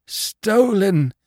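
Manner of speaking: high, drawn-out moan of loss. A narrator's reading. Robbed husband tells his wife, moaning. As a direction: wail it in anguish. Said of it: "he moaned".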